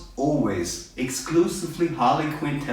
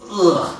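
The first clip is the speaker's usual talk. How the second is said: frustrated ugh; 5 semitones higher, 6 dB louder than usual. Real, not acted. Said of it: "And I'm like".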